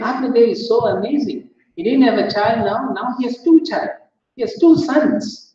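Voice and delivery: high, eager village chatter; excited villagers. Retold or imitated. Villagers marvel at Atmadev's two sons, high and excited. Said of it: "They were so excited".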